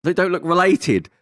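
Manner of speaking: high, loud astonishment; disbelief. Retold or imitated. Shocked reaction to photos.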